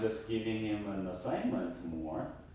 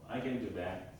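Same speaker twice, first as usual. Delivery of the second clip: lower, softer, half-hearted reply; reluctant agreement; 4 semitones lower, 8 dB quieter, faster than usual. Shown as a demonstration. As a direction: agree without any keenness, voice dropping. Demonstrates willing versus reluctant reply.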